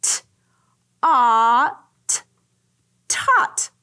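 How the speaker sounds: segmented T, a, t then tot; sounding out. Shown as a demonstration. Teacher blending taught with the class. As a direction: blend it step by step. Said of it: "Let's try it".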